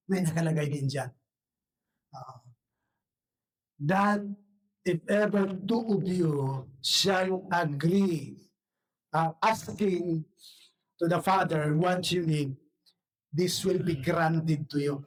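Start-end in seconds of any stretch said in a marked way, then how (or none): 3.8-15.0 1 slow scripture reading. Acted. Reads scripture verse slowly.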